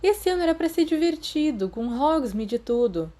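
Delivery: high, whiny lament. A narrator's reading. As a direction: sulk about the lost fun. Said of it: "Peter lamentou". (lamento agudo e choroso)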